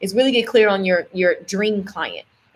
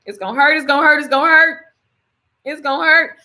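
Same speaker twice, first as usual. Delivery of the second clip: building pain; 6 semitones higher, 3 dB louder than usual. Real, not acted.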